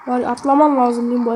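ordinary talk, nothing marked

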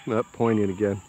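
usual speaking voice

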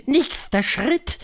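high, slow, drawn-out witch voice; high-pitched, deliberate witch lecturer; Grand High Witch. A narrator's reading. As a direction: spell out the recipe slowly in a high witch voice.

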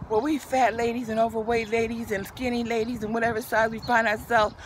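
normal talking voice

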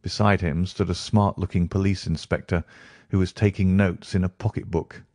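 usual speaking voice

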